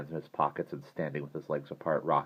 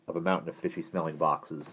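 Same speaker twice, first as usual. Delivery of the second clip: ordinary delivery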